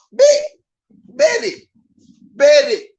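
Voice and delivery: high, loud, punchy calls; eager bet-taking. Real, not acted. Streamer snapping up an easy bet, excited and loud.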